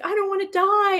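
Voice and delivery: a hint of a scared little girl, voice lifted up; scared child. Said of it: "she's telling me".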